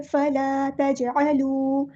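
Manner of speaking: फला तजअलू drawn out, जीम bounced; slow recitation with qalqala. Shown as a demonstration. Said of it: "जीम पे हमारा कलकला हो रहा है". (जीम पर कलकला के साथ पढ़ना)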